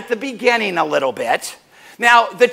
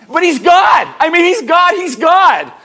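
louder and higher, hammering God; proclaiming loudly; 7 semitones higher, 6 dB louder than usual. Acted. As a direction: shout it out: he's God!.

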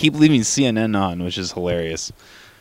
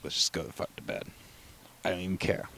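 near-whispered, much softer muttered giving-up; defeated self-talk; 12 dB quieter than usual. Retold or imitated. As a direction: breathe it out like you've given up on the day. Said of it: "I'm like".

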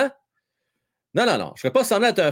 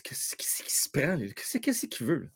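incredulous mutter; 3 semitones lower, 10 dB quieter than usual. Retold or imitated. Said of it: "Au début, tu dis".

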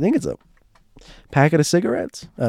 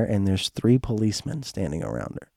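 ordinary talk, nothing marked

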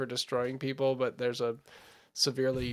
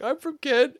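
high, louder shouted reply; mock heckler reply; 10 semitones higher, 5 dB louder than usual. Audience member answering the drunk comic, high mock call. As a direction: call it from the back.

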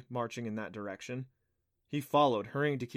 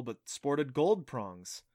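ordinary delivery

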